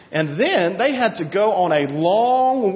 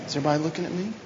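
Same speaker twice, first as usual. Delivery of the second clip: softer repeat; 2 semitones lower, 9 dB quieter, much faster than usual. Shown as a demonstration. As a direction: drop the volume and ask again gently. Repeats the question much softer.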